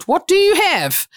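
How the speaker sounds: high, slow, incredulous demand; exasperated question. Retold or imitated. Official to applicant lacking the papers, exasperated. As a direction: imitate an official running out of patience. Official losing patience after nopes.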